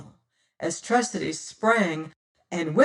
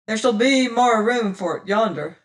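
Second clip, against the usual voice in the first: a lightly voiced cold captain's order, louder; pirate captain; 4 semitones higher, 6 dB louder than usual. Pirate captain ordering his crew to the beach, cool and commanding.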